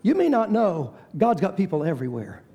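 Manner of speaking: usual speaking voice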